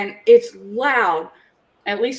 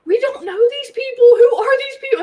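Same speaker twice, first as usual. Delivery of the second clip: high, anxious whine; alarmed kids; 14 semitones higher than usual. Retold or imitated. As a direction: plead in baffled alarm. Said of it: "they're like".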